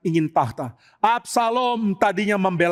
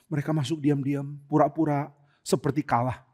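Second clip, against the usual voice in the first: ordinary delivery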